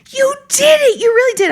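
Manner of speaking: very high, loud cry of delight; thrilled. Retold or imitated. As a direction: imitate an overjoyed student. Retold student hugging her, thrilled.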